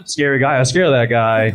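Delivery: ordinary delivery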